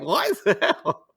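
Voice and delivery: loud, high, drawn-out disbelief; mock outrage. Imagining the family's reaction to his politics, mock outraged. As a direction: play a scandalized parent, laughing. Acts out shocked family reply.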